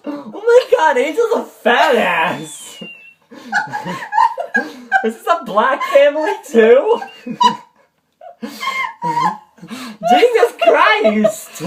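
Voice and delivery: high, loud, cracking up between phrases; helpless laughing. Real, not acted. Reader losing it over her own story, helpless laughter. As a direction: squeal the words through real laughter.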